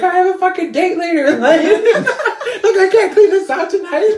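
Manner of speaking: high, loud tearful wail; hammed-up sobbing complaint, laughing through it; mock crying. Imitating the upset actress with a date later, mock crying. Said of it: "like, crying 'cause she's like".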